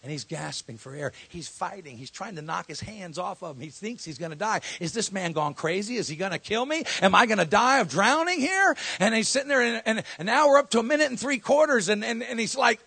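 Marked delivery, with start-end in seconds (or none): 4.8-12.8 higher and louder, rising panic; building alarm at the drowning; panicked build. Acted. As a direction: climb into frantic storytelling.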